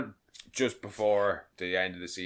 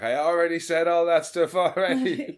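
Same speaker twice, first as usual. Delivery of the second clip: high, loud, exasperated call; shouting back; 9 semitones higher, 7 dB louder than usual. Acted. Imitating Nathan shouting back to Pickles, loud and high. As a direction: yell it back across the room. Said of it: "Nathan shouting back to Pickles".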